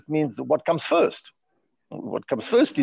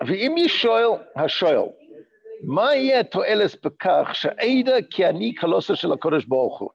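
higher, faster chant of the Hebrew; text reading; 5 semitones higher, much faster than usual. Acted. Teacher reading the sefer aloud, quick study chant.

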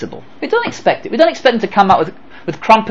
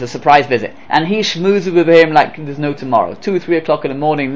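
ordinary talk, nothing marked

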